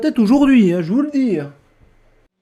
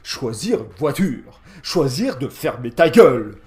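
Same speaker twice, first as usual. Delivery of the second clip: mocking the announcer voice; 7 semitones lower than usual. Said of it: "c'est ce que j'aurais dit".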